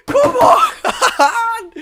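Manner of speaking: a high cheer breaking into laughter; laughing cheer. Real, not acted.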